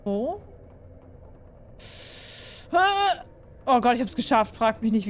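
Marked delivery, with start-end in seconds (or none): 2.7-3.2 short high yelp of fright; startled cry. Real, not acted. Gamer caught off guard, high startled yelp. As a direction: yelp as something jumps out. Sudden scare in game.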